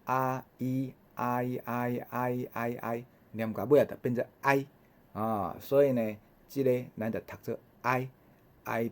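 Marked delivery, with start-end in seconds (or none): 0.0-3.0 啊伊 repeated, gliding 啊 into 伊 ever tighter until it becomes 哀; teacher sliding 啊伊 together over and over; softer drilled glide of 啊伊; sounds blended faster. Shown as a demonstration. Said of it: "甲念较紧的".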